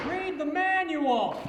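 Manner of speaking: high, slow sage voice; mock wise mentor. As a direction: pronounce it like an all-knowing sage. Deadpan advice, manual lesson.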